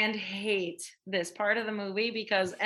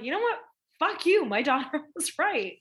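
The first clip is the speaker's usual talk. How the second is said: mock outburst; 7 semitones higher, 4 dB louder than usual. Teacher's retold outburst.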